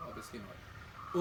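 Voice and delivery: slow, breathy whisper; whispered aside. Acted. Host teasing viewers who missed the news, whispered and slow. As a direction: drop to a sly whisper.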